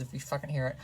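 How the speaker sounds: usual speaking voice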